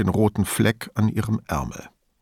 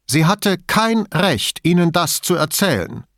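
auffahrend; 8 semitones higher, 3 dB louder than usual. A narrator's reading. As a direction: flare up, not wholly convincingly. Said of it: "fuhr Dottoressa del Balzo auf". (zornig auffahrend, nicht ganz überzeugend)

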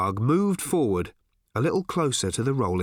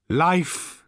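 higher, weighty single word; solemn pronouncement; 5 semitones higher than usual. A narrator's reading. Old Thrashbarg blesses departing Arthur, pronouncing solemnly. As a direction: let the word ring out like prophecy.

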